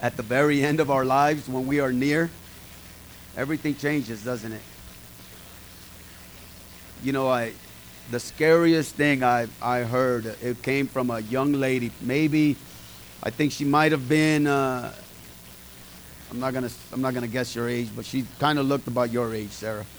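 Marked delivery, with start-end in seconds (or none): none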